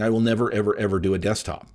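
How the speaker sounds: normal talking voice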